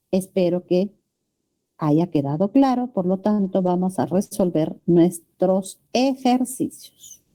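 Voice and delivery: usual speaking voice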